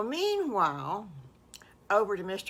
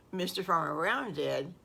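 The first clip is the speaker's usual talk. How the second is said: ordinary delivery, nothing marked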